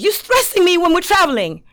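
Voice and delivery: high-pitched retold griping; exasperated complaint. Girlfriend to boyfriend while traveling, exasperated.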